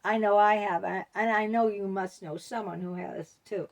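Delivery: ordinary delivery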